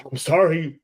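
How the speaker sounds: ordinary talk, nothing marked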